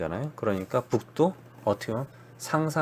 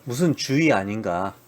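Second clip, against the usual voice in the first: ordinary talk, nothing marked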